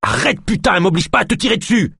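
high, fast, strained warning; furious threat. A narrator's reading. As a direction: threaten while wrestling someone down. Threatens the struggling screamer. (menace exaspérée, aiguë et pressée)

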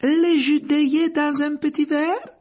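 high, slow, bright-voiced barmaid. A narrator's reading. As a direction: lift the voice high and serve the drinks sweetly. Narrator voices barmaid Rosmerta.

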